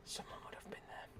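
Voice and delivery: breathy whisper. Real, not acted. Gamer whispering while sneaking through a maze. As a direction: whisper it to yourself.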